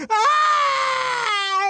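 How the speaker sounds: long, very high held scream; piercing scream. A narrator's reading. Clara screams as though her throat were cut, piercing. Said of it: "as though she were having her throat cut".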